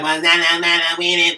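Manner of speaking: lightly voiced [name] rap; mumble-rap it like [name]; half-hearted [name] imitation. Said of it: "that's my [name] voice".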